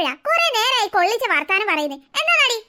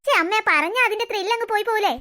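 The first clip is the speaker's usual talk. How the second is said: ordinary talk, nothing marked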